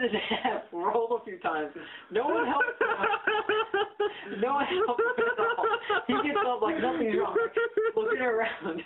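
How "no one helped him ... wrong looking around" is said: real high-pitched laughter through the words; laughing hard. Real, not acted. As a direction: barely get it out for laughing. Cracking up telling the fall.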